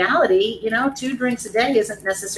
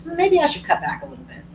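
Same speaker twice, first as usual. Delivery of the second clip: higher, quick mock pondering; musing thinker; 10 semitones higher, 4 dB quieter, faster than usual. A drinker privately considering cutting back, mock musing. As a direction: imitate someone wondering aloud to themselves. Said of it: "anyone who thinks".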